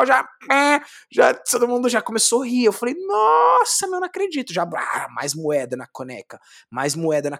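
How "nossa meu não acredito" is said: much higher, louder retold amazement; amazed. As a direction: squeal like you can't believe your luck. (espanto agudo e exagerado)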